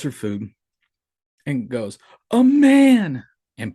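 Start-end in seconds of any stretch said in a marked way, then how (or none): 2.3-3.2 much higher and louder frightened cry; imitating a scared toddler's cry. Dad imitates his scared toddler daughter pointing at something, high frightened shout. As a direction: blurt it out like a terrified little child.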